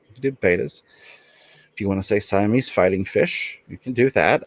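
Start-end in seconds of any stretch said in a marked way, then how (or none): 0.3-0.8 betas said as BAY-duhz; first syllable of betas rhymes with bay; alternate pronunciation. Shown as a demonstration. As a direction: say betas with a long ay. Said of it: "if you prefer to pronounce it betas".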